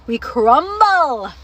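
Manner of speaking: CRUMBLE drawn out long and loud; emphatic. Real, not acted. Confiding how mom affects her: loud, drawn-out stress on crumble. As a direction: belt out a slow, stretched "crumble".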